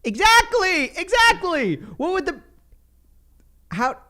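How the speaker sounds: high, loud excited outburst; emphatic agreement. Real, not acted. Host loudly backing the guest's point, excited.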